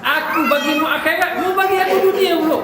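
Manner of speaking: tengkingan tinggi dan kuat yang makin memuncak; marah. Retold or imitated. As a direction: marah sambil tergelak kecil. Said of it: "Dia marah lagi khalifah". (ulama memarahi khalifah yang menawarkan hadiah, suara tinggi dan kuat)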